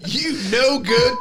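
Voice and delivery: slow high yelled insult; old man scolding an intruder; shouting at trespasser. Pretend. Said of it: "yell at 'em".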